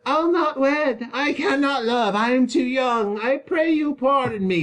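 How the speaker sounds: very high whiny mimic of the daughter; mock her in a squeaky childish voice; father parodying his daughter's refusal; mocking Juliet. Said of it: "he mocks her here".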